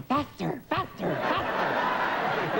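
drawn-out urging to speed up. Retold or imitated. Tipsy passenger eggs on the Concorde, drawn-out urging. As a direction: egg the plane on, stretching it out.

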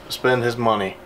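normal talking voice

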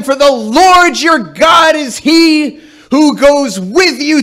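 high, loud battle-cry speech; rally the troops like a general; rousing battle speech. Retold or imitated. Priest's epic pep talk read.

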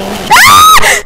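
sudden high scream of fright; startled scream. Real, not acted. Gamer screaming at another jump scare. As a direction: shriek as it jumps out.

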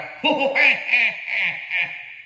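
imitated baby's belly laugh; mock newborn guffaw. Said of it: "bayine lahir ngguyu ngwakak". (ngguyu ngakak niru bayi)

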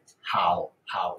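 好 low and dipping, sinking to a near whisper; 好 in a low quiet dipping tone; third tone demo. Shown as a demonstration. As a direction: say 好 low and falling away softly. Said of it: "It's not".

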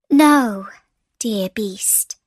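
plain reading voice

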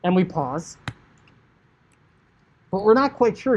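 ordinary talk

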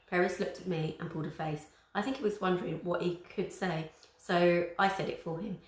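ordinary delivery

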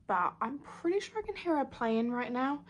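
normal talking voice

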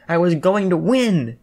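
loud, high, drawn-out complaint; frustrated cry. A narrator's reading. Jiro protests losing the card game, frustrated cry. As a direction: wail it like a cheated player. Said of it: "Jiro cried".

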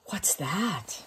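gasped whisper of wonder; whispering in wonder at the page. Real, not acted. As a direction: hush it with curiosity. Gasp at a new picture.

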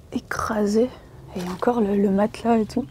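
softly whispered word. Real, not acted. Pointing out the crushed floor, whispered. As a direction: breathe it out in a whisper. Sneaking through abandoned houses.

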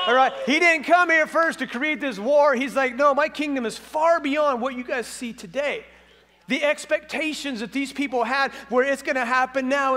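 high-energy sermon delivery; forceful preaching. Real, not acted. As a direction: proclaim it with rising conviction to the congregation.

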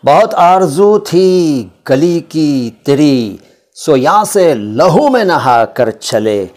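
slow, drawn-out recital of a sher; slow Urdu couplet recital; poetry recitation. Acted. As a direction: savour each word like a mushaira verse.

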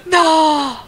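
high, loud cry of no; panicked yell. Real, not acted. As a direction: scream it in panic. Panicked game moment.

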